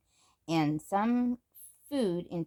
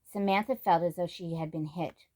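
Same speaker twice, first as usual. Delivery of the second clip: usual speaking voice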